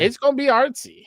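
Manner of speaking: playfully pompous; mock artsy. Repeats the joke, bigger.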